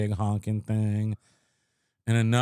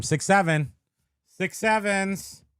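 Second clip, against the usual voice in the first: high, drawn-out "Six sevens"; meme catchphrase; 9 semitones higher, slower than usual. Pretend. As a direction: sing the meme slowly, high.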